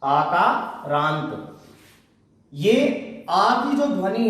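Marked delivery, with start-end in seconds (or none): 0.0-1.6 आकारांत stretched very slowly, each syllable clear, falling at the end; slow repeat. Shown as a demonstration. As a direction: drill आकारांत slowly so pupils catch each sound. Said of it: "इसको हम क्या कहेंगे". (आकारांत को बहुत धीरे, हर अक्षर साफ़)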